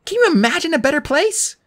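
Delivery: put-on high, enchanted girl voice; lost in delight; dreamy girl voice. A narrator's reading. Annabeth dreamy about casino.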